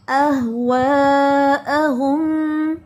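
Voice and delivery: अह वा अहुम slow, lips rounded. Shown as a demonstration. Teacher repeating the corrected word, slow. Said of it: "होंठ गोल करके".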